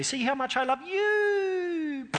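high, slow, gushing mock declaration; mock sentimental. Preacher mocking a pointless sacrificial gesture, high and slow. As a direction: overplay a syrupy martyr, drawn out high. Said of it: "run off a cliff and say".

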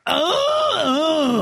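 squeaky high moaning oh; hammed-up high-pitched moan. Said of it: "It was like a".

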